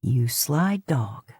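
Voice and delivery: sly teasing; smirking. A narrator's reading. Dean teases the horse Mato, slow and smirking. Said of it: "Dean remarks, smirking".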